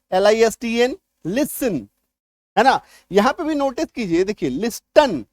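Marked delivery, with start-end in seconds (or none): 4.5-5.2 "लिस्टन" with a hard T sounded after the S; spelling pronunciation. Shown as a demonstration. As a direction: read "लिस्टन" as spelt, T included. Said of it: "कायदे से इसको लिस्टन पढ़ दें". ("लिस्टन" में ट बोलकर)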